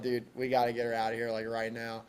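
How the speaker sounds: hushed. Retold or imitated. Quoting friend's urgent hushed text.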